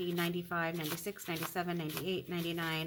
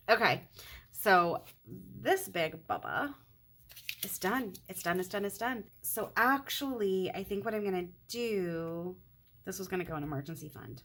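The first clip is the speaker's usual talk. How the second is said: normal talking voice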